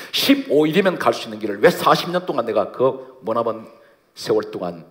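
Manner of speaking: loud rhetorical challenge; forceful divine questioning. Acted. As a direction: demand the answer, loud and pressing. God's question voiced with force.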